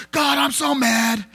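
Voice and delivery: slow, drawn-out mock shout at God; mad lament. Shown as a demonstration. Musician acting the angry lament psalm, slow mock shout. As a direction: cry out in staged anger, stretching each word. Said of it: "So it was like".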